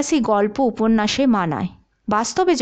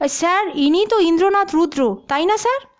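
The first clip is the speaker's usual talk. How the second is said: surprised recognition; 6 semitones higher than usual. Acted. Officer suddenly recognises Indranath.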